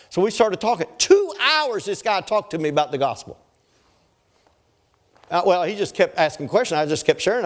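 full-force preaching. Acted. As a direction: preach it out to the whole congregation.

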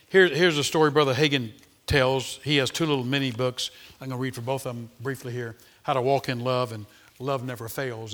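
ordinary delivery

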